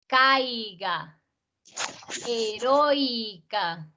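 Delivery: each word alone, stressed vowel pair stretched in one glide, as in "Cautela"; stressed diphthong demonstration. Shown as a demonstration. Teacher reciting diphthong examples for the class, drawn-out stressed syllables like "Peinar". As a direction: dwell on the diphthong, as in "Heroica", then pause. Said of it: "¿y quién tiene la fuerza?".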